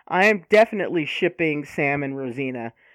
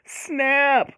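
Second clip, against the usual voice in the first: delighted exclamation; 9 semitones higher, 7 dB louder, much slower than usual. Real, not acted. Reacting to a burn.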